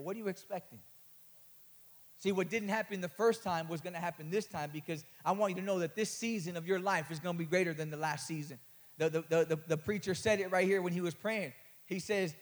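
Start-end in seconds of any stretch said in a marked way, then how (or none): none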